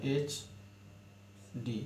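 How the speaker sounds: normal talking voice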